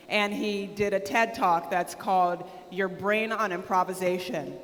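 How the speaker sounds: ordinary talk, nothing marked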